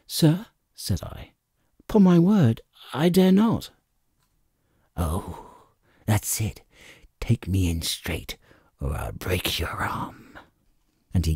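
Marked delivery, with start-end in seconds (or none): 0.1-0.5 high, startled plea; frightened boy. A narrator's reading. Jim to the blind man, frightened. 1.8-3.7 put-on frightened boy. A narrator's reading. Jim refuses the blind man, frightened. 4.9-10.4 low, soft, hissed threat; menacing threat. A narrator's reading. Blind Pew threatens Jim, low and soft.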